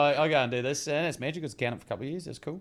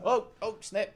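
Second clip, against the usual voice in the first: mock startled cry; 11 semitones higher than usual. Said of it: "reacting to near hit".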